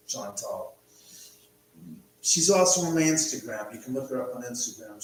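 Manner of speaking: ordinary talk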